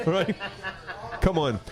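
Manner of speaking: normal talking voice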